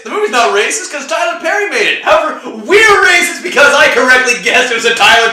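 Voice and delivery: loud, high-pitched rant; heated rant. Real, not acted. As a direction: shout the point at the others. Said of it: "heated rant about the movie".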